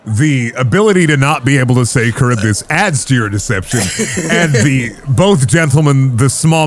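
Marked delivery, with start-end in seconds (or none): none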